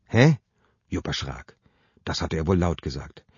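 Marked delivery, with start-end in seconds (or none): 0.1-0.4 verwirrt. Acted. Sabine reagiert verwirrt.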